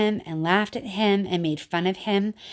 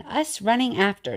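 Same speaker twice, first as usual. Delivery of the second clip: ordinary delivery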